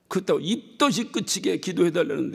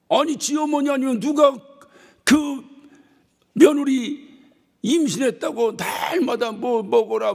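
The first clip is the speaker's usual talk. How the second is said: preaching shout; 6 semitones higher, 4 dB louder, slower than usual. Real, not acted. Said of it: "preacher raising voice".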